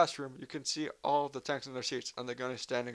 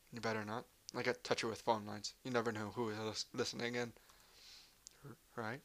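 hushed warning; 9 dB quieter than usual. Acted.

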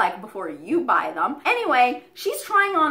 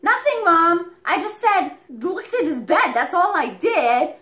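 higher-pitched defensive protest; retold protest of innocence; 6 semitones higher, 4 dB louder than usual. Daughter tells Mom she did nothing, higher-pitched protest. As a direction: plead innocence to a parent.

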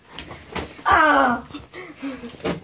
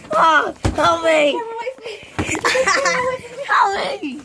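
playful cry for help; 8 semitones higher, slower than usual. Pretend. Kid play-fighting, calling for help.

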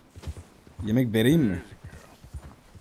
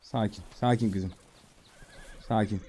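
soft, low calming voice; soothing; 5 semitones lower, 8 dB quieter than usual. Pretend. Player calming a spooked horse, soft and soothing. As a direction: gently settle a nervous animal.

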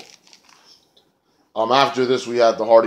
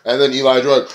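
ordinary delivery

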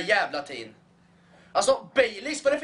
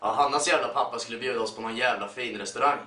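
ordinary talk, nothing marked

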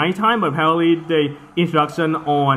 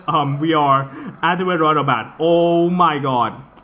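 ordinary talk

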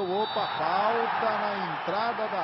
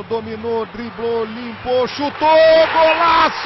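explosive goal commentary; 5 semitones higher, 12 dB louder than usual. Real, not acted. Goal call after dribbles.

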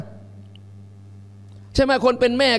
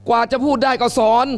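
ordinary talk, nothing marked

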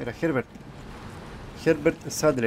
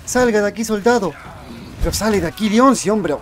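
high, loud, urgent bursts; frantic shouting; 7 semitones higher, 5 dB louder than usual. Real, not acted. Player yells at Leon to run and get out, frantic shouting. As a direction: shout commands in a panic.

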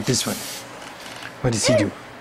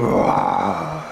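low growled roar demo; dinosaur roar; 6 semitones lower than usual. Shown as a demonstration. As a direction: show the child a deep roar. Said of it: "Can you go".

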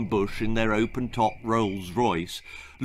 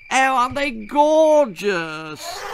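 put-on gushing girl; 12 semitones higher, 5 dB louder, slower than usual. Belinda gushes over elephants.

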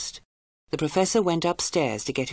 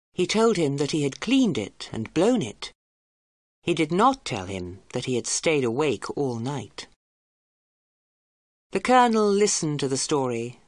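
ordinary delivery, nothing marked

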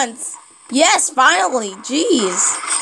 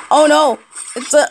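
ordinary delivery

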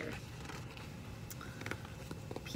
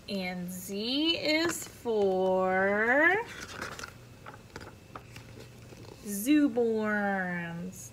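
drawn-out suspense; slow grand ending; slow build; much slower than usual. Acted. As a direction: stretch it out for the finale.

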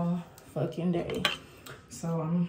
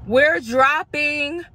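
excited; 8 semitones higher, 8 dB louder, much slower than usual. Acted. Hyping the new music.

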